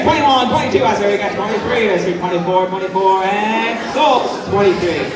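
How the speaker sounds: loud, quick auction calling; auctioneer. Pretend. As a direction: rattle off bids like an auctioneer. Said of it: "I feel like an auctioneer".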